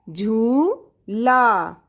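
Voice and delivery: very slow drawn-out झूला; slow dictation. Shown as a demonstration. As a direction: drag out झूला for the class. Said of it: "टू time speak भी करना है".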